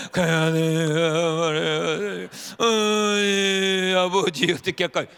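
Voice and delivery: loud, very slow slurred prayer, the words smeared together; mumbled prayer. Retold or imitated. Pastor imitates his unclear mumbled prayers at dawn. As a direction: drag out each word so it can't be made out. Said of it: "이걸 똑똑하게 못하니까".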